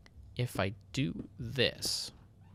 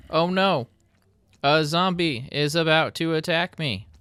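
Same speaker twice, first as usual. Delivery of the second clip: hammed-up panic, higher and louder; mock alarm; 7 semitones higher, 7 dB louder than usual. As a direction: pretend to be scared of the zombie.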